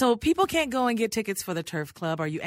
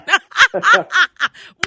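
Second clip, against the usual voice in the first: big high laugh; loud burst of laughter; 9 semitones higher, 14 dB louder than usual. Real, not acted.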